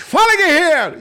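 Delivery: high, loud barracks greeting; officer's shout. Retold or imitated. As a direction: bark it at the recruit. Tenente grita saudação.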